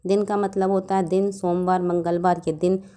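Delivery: usual speaking voice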